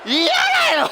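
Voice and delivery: loud, high-pitched cheer; excited shout. Real, not acted. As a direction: shout it out high over the action. Cheering during wrestling play-by-play.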